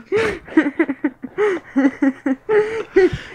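nervous laugh. Real, not acted.

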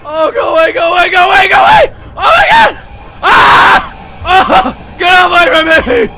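loud high frantic yelling; terrified screaming. Real, not acted. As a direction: flee screaming for your life. Chased, screaming in terror.

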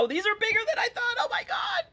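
high, fast shriek of amazement; surprised. Real, not acted. To viewers finding big eyeballs, surprised shriek. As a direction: squeal at how big they are.

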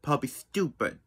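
ordinary talk

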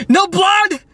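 high, squealed question; hammed-up incredulous squeal; mock disbelief. Theatrically checks cut for blood.

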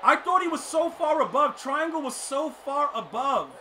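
loud, high-pitched exasperated rant; outraged complaint. Real, not acted. Gamer explains the botched throw, loudly outraged. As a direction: protest loudly in disbelief.

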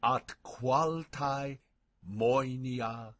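slow, deliberate Latin, phrase by phrase with pauses; measured pronunciation model, unhurried and carefully spaced; very slow recitation. Shown as a demonstration. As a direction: recite very slowly so learners can follow along. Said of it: "I'll go very slowly".

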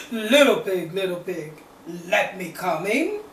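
loud wolf calling at the door; a hint of big bad wolf, loud. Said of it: "and he said".

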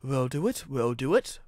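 light puffed rhythm; puffing. A narrator's reading. Engine straining with the train, puffed. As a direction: puff it out in short repeats. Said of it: "puffed Edward".

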